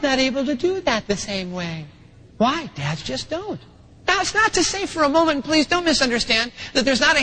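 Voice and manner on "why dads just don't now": usual speaking voice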